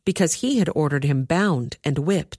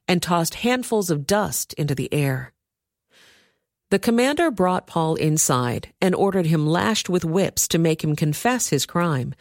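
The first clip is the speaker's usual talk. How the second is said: plain reading voice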